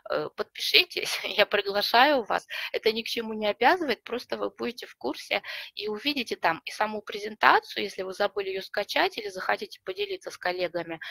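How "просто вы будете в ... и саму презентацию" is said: ordinary talk, nothing marked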